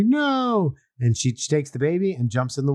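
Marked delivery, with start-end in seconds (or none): 0.0-0.7 shouting no. Acted. Maid chasing, shouts no.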